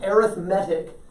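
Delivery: adjective arithmetic, stressed air-ith-MET-ic; stress on third syllable. Shown as a demonstration. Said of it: "it becomes an adjective".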